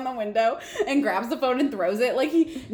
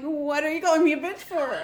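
mock-hurt, slightly higher protest; near tears; 4 semitones higher than usual. Sam to Dean after being called a bitch, near tears. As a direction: act mildly wounded, on the verge of tears. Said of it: "near tears".

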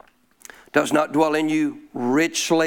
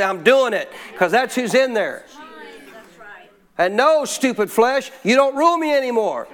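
raised, high preacher's voice; impassioned preaching; 5 semitones higher than usual. Real, not acted. Preacher driving a verse home to the congregation, impassioned. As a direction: preach it with rising force, stretching key words.

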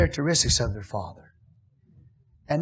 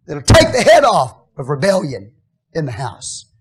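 loud, rising preacher's climax; full-force preaching; 5 semitones higher, 8 dB louder than usual. Acted. Pastor preaching loudly to his church. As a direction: preach it hard to the congregation.

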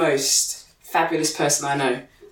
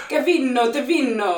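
mock-Italian accent, pitched up; 5 semitones higher than usual. Shown as a demonstration. Trying out a guest's name, Italian accent. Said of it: "should I say it in an Italian accent".

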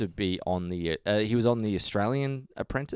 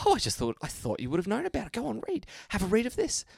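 put-on high, pushy old nan, barely restraining her excitement; lightly voiced grandma urging her to read; eager nan; 12 semitones higher, 5 dB quieter than usual.

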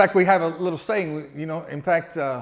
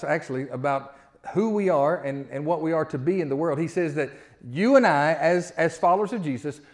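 usual speaking voice

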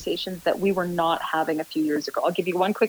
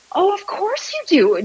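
high, louder and slow, bursting with realization; excited exclamation; 12 semitones higher, 6 dB louder, slower than usual. Retold or imitated. Mother telling her daughter she understands, excitedly. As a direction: let it dawn on you and ring out delighted.

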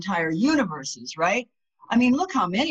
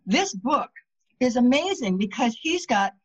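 ordinary talk, nothing marked

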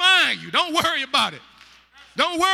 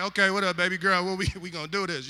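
smooth talker; 7 semitones lower, 6 dB quieter, faster than usual. Retold or imitated. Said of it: "he like".

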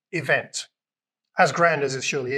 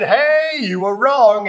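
loud, higher hey, gloating; triumphant call-out; 5 semitones higher, 6 dB louder, much slower than usual. Pretend. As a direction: call out the high priest in triumph. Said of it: "gone to the high priest and said".

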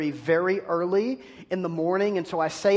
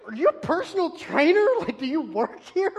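mocking challenge; 10 semitones higher than usual. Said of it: "I'm like".

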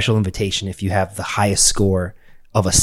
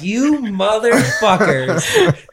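much higher, slow, laughing through it; laughing mock outrage; 11 semitones higher, much slower than usual. Realizing he won the gym award, laughing disbelief.

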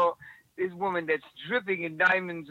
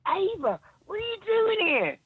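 high-pitched mock astonishment; retold squeal of surprise, pitched way up; astonished greeting; 13 semitones higher, 4 dB louder than usual. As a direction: imitate someone amazed at who just turned up. Bodyguard astonished to see her.

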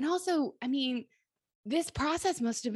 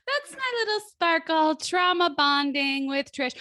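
singsong announcement; 9 semitones higher, 5 dB louder than usual. Real, not acted. Announces her sparkle in singsong.

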